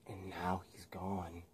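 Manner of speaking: soft slow whisper; slow sinister whisper. Acted. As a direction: breathe it out slowly. Harry's whisper.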